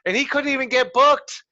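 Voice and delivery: loud, high-pitched disbelief; excited outburst. Real, not acted. Wrestler reacting to a colleague's snub from bookers, loud and excited. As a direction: burst out, pitch jumping high.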